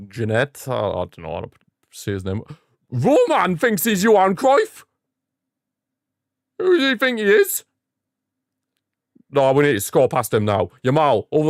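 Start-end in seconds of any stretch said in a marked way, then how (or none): 2.9-7.6 much higher, slowed indignant cry; outraged disbelief. Real, not acted. Gamer raging at the rival keeper in high indignant disbelief. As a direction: stretch the words out in mock outrage.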